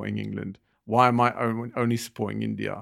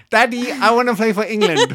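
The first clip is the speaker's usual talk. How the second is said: high, loud, laughing mock plea from a grown son; hammed-up higher son voice, giggling through it; son asking dad; 10 semitones higher, 7 dB louder than usual. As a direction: squeak it out like a son begging his dad, laughing. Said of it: "When he's at twenty-five".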